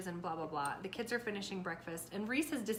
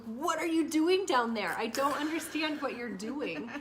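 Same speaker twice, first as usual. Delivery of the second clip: higher, louder, slowed scolding; exasperated scolding; 4 semitones higher, 6 dB louder, slower than usual. Retold or imitated. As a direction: demand to know what he's doing.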